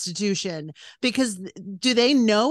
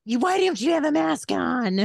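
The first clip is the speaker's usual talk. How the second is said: high-pitched screechy imitation of a scolding shopper; mock screaming; 5 semitones higher than usual. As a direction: imitate someone screaming an accusation. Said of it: "screaming at me and saying".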